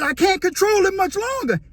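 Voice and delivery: mock strained warning. Cellmate struggling with his own hand.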